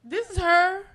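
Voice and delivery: high, loud re-enacted reply; acted-out high phone voice; high-pitched phone answer. Acting out answering the surprise call.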